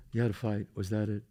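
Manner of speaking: soft, low imitation of sympathy; hushed mock-consoling voice. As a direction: play the gentle comforter, voice dropped. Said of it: "they start consoling you".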